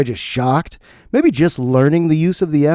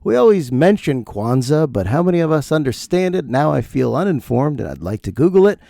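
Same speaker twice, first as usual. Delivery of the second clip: ordinary delivery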